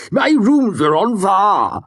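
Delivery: slow, loud, high mock Ambrose accent; Ambrose's accent. Sim mocking Ambrose at the fire, slow mock accent. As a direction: drag it out in Ambrose's put-on accent. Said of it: "imitation of Ambrose's vintage accent".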